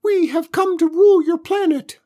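very high, loud squeaky alien; tiny alien voice. Acted.

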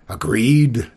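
a loud, hearty roar of a word. A narrator's reading. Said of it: "the dragon roared".

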